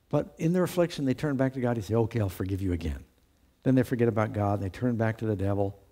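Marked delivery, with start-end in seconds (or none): 2.0-3.0 lower, softer, patient sigh; a long-suffering God giving in, low and soft; resigned forgiveness. Retold or imitated. Voicing God forgiving again.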